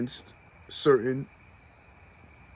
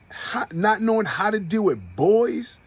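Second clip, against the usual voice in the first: ordinary delivery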